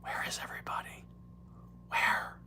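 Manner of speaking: soft, fast whispered Biden; Joe Biden whisper. Retold or imitated. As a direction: hush it like Biden leaning in. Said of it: "I'm doing my Joe Biden again".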